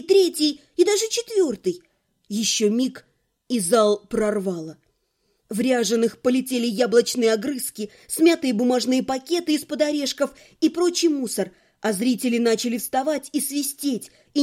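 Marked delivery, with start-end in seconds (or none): none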